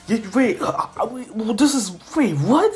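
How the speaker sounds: high, stammering double take; flustered confusion. Acted. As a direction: stumble over it, baffled. Boy confused by news.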